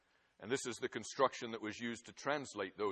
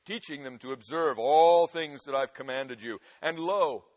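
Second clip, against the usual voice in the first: louder proclaiming read; preaching scripture aloud; 7 dB louder than usual. Acted. Preacher to congregation, loud scripture proclamation. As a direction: ring out Christ's commission. Preacher reads the Great Commission.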